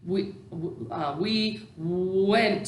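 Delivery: "went" with a long, drawn-out w at the start; sounding out first sound. Shown as a demonstration. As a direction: slowly get into the w of "went". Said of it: "get our mouth ready to say the first sound".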